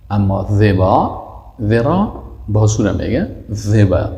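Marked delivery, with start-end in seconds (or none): none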